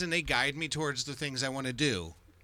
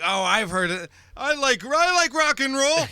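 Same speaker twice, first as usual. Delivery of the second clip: higher, louder eager outburst; mock-enthusiastic cheer, pitched up; excited rock fan; 11 semitones higher, 6 dB louder than usual. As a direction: blurt it out like a keen fan. Acting out excited rock fans.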